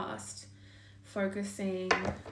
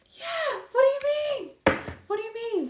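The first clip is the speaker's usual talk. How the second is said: much higher and louder, stunned; real shock; 9 dB louder than usual. Real, not acted. Reader reacting to the drawn cards, loud high shock. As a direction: cry it out in real astonishment.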